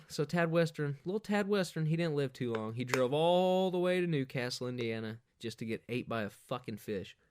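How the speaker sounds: ordinary talk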